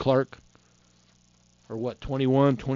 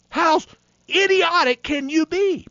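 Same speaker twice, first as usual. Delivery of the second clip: incredulous scorn; 12 semitones higher than usual. Real, not acted. Preacher scoffing at unbelievers.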